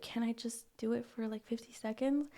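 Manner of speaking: hushed, timid request; soft pleading. Retold or imitated. Riley asks Mia for fifty seconds, softly pleading. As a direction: ask softly, as if sneaking permission.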